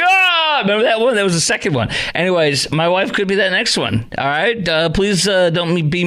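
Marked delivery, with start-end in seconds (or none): 0.0-0.6 mock scream. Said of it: "And there was like".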